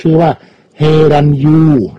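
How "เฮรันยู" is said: "เฮรันยู" with Ryu said as "yu"; R silent. Shown as a demonstration. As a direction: say "ยู" in "เฮรันยู" with no R sound. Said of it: "ตัว R ไม่ออกเสียง".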